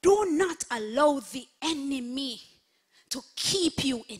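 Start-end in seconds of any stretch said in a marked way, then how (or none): none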